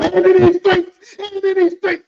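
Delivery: high, loud, stretched-out jeer; mock proclamation. Storyteller ribs the coworker who backed down, loudly mocking. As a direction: belt it out high, making fun.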